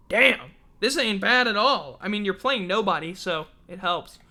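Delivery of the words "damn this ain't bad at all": ordinary talk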